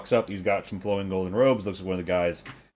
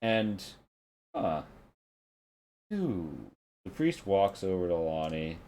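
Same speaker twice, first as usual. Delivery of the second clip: ordinary talk, nothing marked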